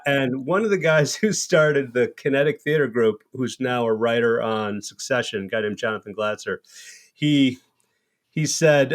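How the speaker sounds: normal talking voice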